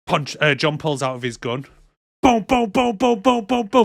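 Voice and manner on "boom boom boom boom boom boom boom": louder, much higher spoken boom boom gunfire; kid-style gunshot noises, punched and high; mock gunshots. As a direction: fire off each boom like a cartoon gun. Mimicking gunshots in retelling.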